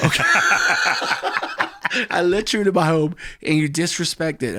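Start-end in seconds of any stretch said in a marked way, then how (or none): 2.1-4.6 high, loud hurt dude voice; mock wounded friend. Hurt friend complaining about his home, high and aggrieved. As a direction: whine about being disrespected.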